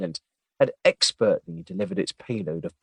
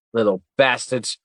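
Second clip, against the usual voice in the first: usual speaking voice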